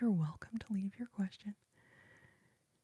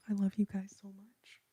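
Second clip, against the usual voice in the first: ordinary delivery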